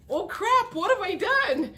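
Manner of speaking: high hammed-up dismay; sudden mock realization of a mistake; mock panic. Gemini regretting the fight, high mock panic.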